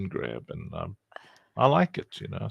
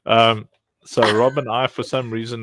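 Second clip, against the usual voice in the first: ordinary talk, nothing marked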